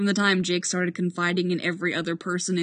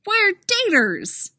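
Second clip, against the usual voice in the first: bright and cheerful; 13 semitones higher, 7 dB louder, slower than usual. Acted. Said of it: "Santiago confirms with a bright smile".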